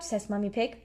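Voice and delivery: plain reading voice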